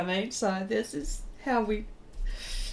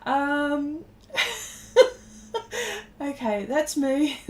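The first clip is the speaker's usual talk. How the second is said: giggling through the words, pitched up; laughing hard; 7 semitones higher than usual. Real, not acted. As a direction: crack up and talk through the laughs. Real laughter after funny story.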